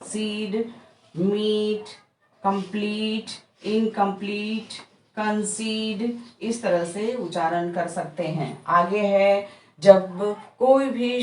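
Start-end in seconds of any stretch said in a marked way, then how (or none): 0.0-6.2 सीड, मीट, कंसीड with a drawn-out long ee, कंसीड slow; long ee demo. Shown as a demonstration. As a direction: repeat the long ee words, stretching the last one. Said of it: "ई का उच्चारण करते हुए".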